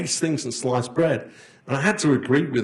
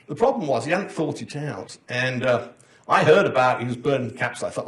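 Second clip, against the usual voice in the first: ordinary talk, nothing marked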